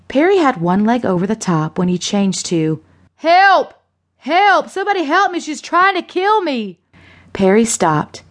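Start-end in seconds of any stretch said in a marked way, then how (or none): 3.2-6.8 high, panicked shouts for rescue; screaming for help. A narrator's reading. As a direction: cry out to anyone who can hear. Dangling man calls for rescue.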